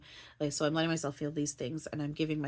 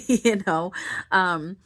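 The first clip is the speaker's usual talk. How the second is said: breaks into a laugh on the word, louder and higher; 3 semitones higher, 10 dB louder, slower than usual. Real, not acted. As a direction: laugh it off mid-sentence, slowly. Laughing at her own metaphor.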